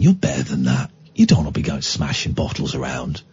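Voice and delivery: low, soft, gentle reproach; softened tone. Retold or imitated. Driver talking down an upset bottle smasher, softened tone. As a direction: quietly coax him to behave better. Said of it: "then I softened my tone".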